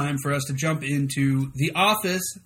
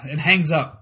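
normal talking voice

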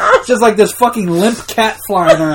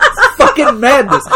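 ordinary delivery

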